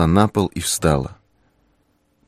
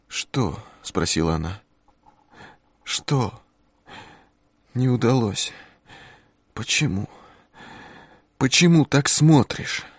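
high, hesitant woman's voice; lost and bewildered; confused young woman; 4 semitones higher, 3 dB quieter than usual. A narrator's reading.

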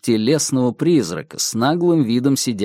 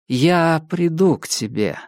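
higher, lightly voiced womanly double; a promise pitched up, with a hint of a woman's voice; understated feminine character voice, raised pitch; 5 semitones higher than usual. A narrator's reading. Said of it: "насколько женственный у него голос".

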